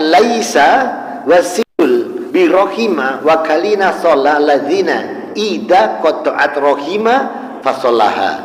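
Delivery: slow, loud Arabic recitation; hadith recitation. Acted. Preacher recites the Prophet's hadith, solemn chant-like.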